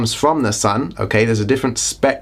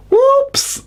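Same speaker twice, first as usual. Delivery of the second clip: very high, squeaky falsetto "whoops"; playful high-pitched oops; mock whoops. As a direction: leap way up as if caught being cheeky. Mock slip after cheeky accent jibe.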